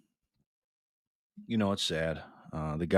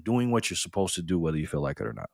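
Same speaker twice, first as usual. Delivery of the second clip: softer, musing echo of the line; quieter repeat; 4 semitones lower, 7 dB quieter, faster than usual. Shown as a demonstration. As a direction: repeat it softly to let it sink in. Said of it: "that's real self-discipline".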